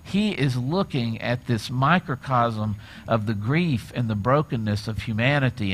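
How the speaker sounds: ordinary talk, nothing marked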